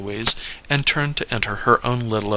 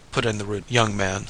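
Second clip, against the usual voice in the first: ordinary delivery, nothing marked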